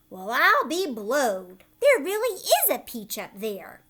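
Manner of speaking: loud, doubting fat aunt voice; scoffing aunt. Acted. Aunt Sponge doubting Spiker about the peach. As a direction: sneer at her sister's claim.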